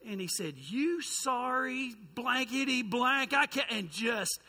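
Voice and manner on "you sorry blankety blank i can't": slow, loud, furious outburst; retold explosion. Angry man blowing up at the youth pastor, slow loud fury. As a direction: spit the words out slowly, exploding. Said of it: "explodes on me".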